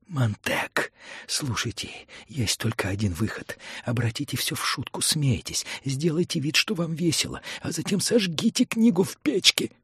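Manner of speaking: soft, increasingly high pleading; urgent little earpiece voice. Acted. Mentor offers Montag a way out, urgently pleading. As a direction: coax him in a small, rising voice.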